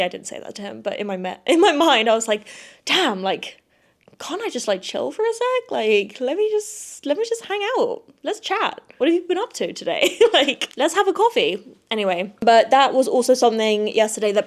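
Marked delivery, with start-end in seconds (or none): 2.8-11.6 a high, teasing plea to just hang out and chat; mock pleading. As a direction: pretend plea to the hurried donut seller, high and playful. Imagined plea to the donut guy.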